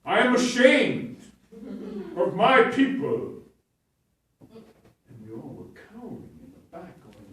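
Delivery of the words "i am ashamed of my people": slow, loud, high, ashamed declaration; grand theatrical scolding. Retold or imitated. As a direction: scold the crowd like a grand tragedian.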